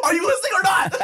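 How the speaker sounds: high mock shout, laughter breaking in; imitating yelling mom. Mom scolding dad instead of child, high laughing mock shout. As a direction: yell it like a fed-up mother. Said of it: "She's like".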